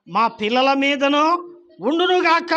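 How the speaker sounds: high, loud rising shout; crowd's cry. Acted. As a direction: cry it out like a mob. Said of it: "అన్నారు".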